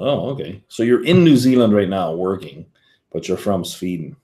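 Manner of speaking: ordinary delivery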